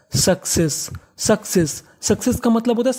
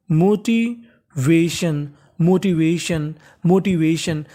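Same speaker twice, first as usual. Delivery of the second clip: each syllable drawn out, motivation spoken very slowly; teacher's slow model reading; slow model word; 2 semitones lower, much slower than usual. Shown as a demonstration. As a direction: sound out motivation slowly for students. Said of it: "teacher models the word slowly".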